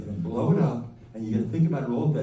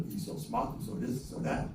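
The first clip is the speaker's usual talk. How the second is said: muttered mock praise; 2 semitones lower, 8 dB quieter than usual. Mocking the son's praise.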